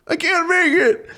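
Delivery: mock a quitter whining he can't cope; whiny chump. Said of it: "one of these chumps that".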